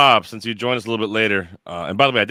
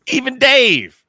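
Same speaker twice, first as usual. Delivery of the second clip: high excited shout; 14 semitones higher, 8 dB louder, much slower than usual. Real, not acted. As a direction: top of the voice, high and loud. Repeats punchline even higher.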